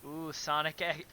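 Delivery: ordinary talk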